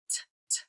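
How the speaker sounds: bare voiceless "t", said twice; two short unvoiced taps of "t"; letter sound T. Shown as a demonstration. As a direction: tick out "t, t" softly with no vowel. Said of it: "Does that sound like p".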